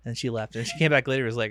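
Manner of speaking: ordinary talk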